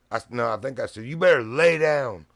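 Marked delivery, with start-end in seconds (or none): 1.1-2.3 loud, high warning to the dog; much louder, higher retold command to lie down; stern command. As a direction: shout it as a firm order. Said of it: "Like that as I punched him".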